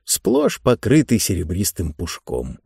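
usual speaking voice

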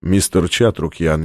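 icy voice. A narrator's reading. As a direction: say the name with chilling calm. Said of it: "в его голосе звучали ледяные нотки".

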